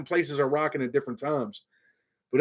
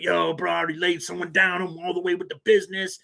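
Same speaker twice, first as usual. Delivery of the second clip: higher-pitched imitation of cocky boasting; talking big; 3 semitones higher than usual. Kid bragging to his man down there, mock swagger. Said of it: "he's down there talking big".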